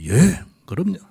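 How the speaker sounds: put-on high, bright eager answer like a proud boy; eager son answering. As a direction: answer like a son keen to please. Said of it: "우리 아들 뭐 크게 잘 대답하듯이". (아들처럼 높고 신나게 대답)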